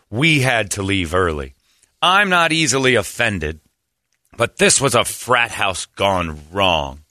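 slow, measured imitation of indignation; mock prim complaint. Reads one lady's offended comment, mock solemn indignation. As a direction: spell out the grievance slowly, mocking the complainer.

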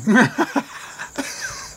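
a burst of high, breathless laughter; a real, high laugh at the punchline. Real, not acted. Laughing at the story's end.

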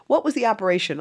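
high, loud, relentless questioning; prying seatmate. Retold or imitated. Seatmate asking about her operation, relentlessly nosy.

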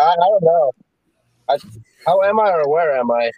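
ordinary talk, nothing marked

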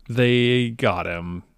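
slow, drawn-out reveal; drawn-out payoff. Acted. Telling his co-host the outcome, slow and drawn out. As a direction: stretch it out like a big payoff. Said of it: "boy howdy, let me tell ya".

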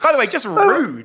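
high, slow mock outrage; mock offended. Real, not acted. Teasing a friend's rude remark, mock offended. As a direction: play at being offended.

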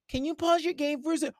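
high, pleading ask; mock needy girlfriend. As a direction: coax him off the game. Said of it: "girlfriend on phone".

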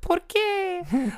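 put-on high squeaky toddler voice; baby voice. Man imitating his baby self on video, high and squeaky. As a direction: imitate himself as a tiny baby asking why. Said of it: "me going, like".

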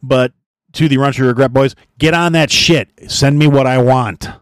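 mock demand. Host demanding a promo from podcast friends, loud mock demand. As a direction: bark a mock order at your buddies.

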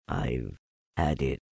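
low, soft, slow donkey; Eeyore, gloomy. A narrator's reading. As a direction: say it low and slowly, resigned.

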